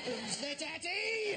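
slow, drawn-out victory boast; triumphant gloat. Retold or imitated. Bloke on the train gloating over his finished Sudoku. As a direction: crow it like you've won. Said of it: "he went".

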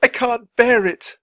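slow, high, loud anguish; anguished cry. A narrator's reading. Man overcome by the graveyard vision, crying out. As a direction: cry out, unable to endure it. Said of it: "cried Charles".

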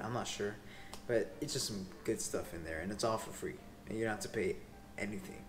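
ordinary talk, nothing marked

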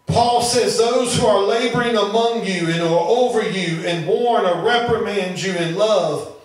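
ordinary talk

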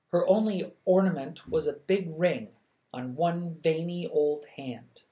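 ordinary delivery

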